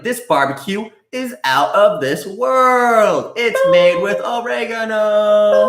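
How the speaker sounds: slow, high, showy TV-chef voice; Guy Fieri impression. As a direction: hype it up like a food show host. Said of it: "You'd be like".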